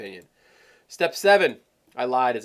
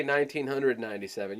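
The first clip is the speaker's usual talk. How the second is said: "nineteen hundred ninety-seven" run together quickly; quick, compact price; 5 dB quieter than usual. Shown as a demonstration. Coach showing how not to quote "nineteen hundred ninety-seven", quick and compact. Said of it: "you don't wanna say".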